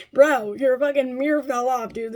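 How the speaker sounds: loud, indignant retelling of a retort; exasperated comeback. Retold or imitated. Telling him his old car is junk, exasperated. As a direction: argue back in exasperation. Acted comeback in mirror quarrel.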